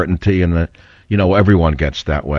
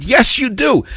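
sharp, much higher insistence; indignant retort; slower than usual. Retold or imitated. Host retorting to the whispering fan, indignant and high. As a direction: snap back in disbelief. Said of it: "then I started to get angry".

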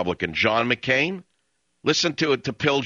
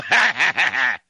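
laughing; 3 dB louder than usual. Real, not acted. Laughs after his impression.

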